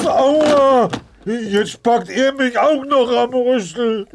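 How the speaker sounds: high-pitched ouch; yelp of pain. Acted. Grabbed by trunk.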